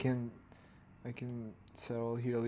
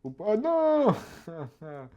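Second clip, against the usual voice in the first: drawn-out, high, loud "oh no"; dismayed cry; 7 semitones higher, 5 dB louder, much slower than usual. Real, not acted. Animator reacting to an iPad slip, loud dismayed wail. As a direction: wail it out as things go wrong.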